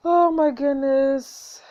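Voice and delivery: overwhelmed gush. Real, not acted. Player overwhelmed by sweet scene.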